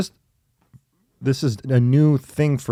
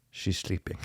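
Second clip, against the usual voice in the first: hushed imitation of dad's French accent; French-accented dad, almost whispered; 3 semitones lower, 12 dB quieter than usual. Dad reporting back on the toddler he put to bed, soft French accent. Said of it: "did you do a French accent".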